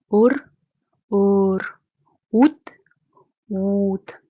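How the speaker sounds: short vowel, then the same vowel held long, word after word; long versus short vowel drill. Shown as a demonstration. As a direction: stretch the second vowel of each pair longer. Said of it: "show you the difference between long and short vowel sounds".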